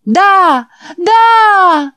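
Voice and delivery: very high, loud yes, yes; crowd shouting yes. Acted. The boys roar yes back at the preacher.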